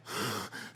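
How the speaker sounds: imitated sharp gasp of fright; quick, breathy mock gasp; terrified gasp. Dad showing his daughter's face at the spill, terrified gasp.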